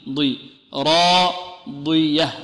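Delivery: very slow "ضي، راضية" with a lightly emphatic dad; light emphasis demo. Shown as a demonstration. Teacher models a light emphatic dad. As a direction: stretch "راضية" with only slight heaviness. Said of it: "في أقل مراتب التفخيم".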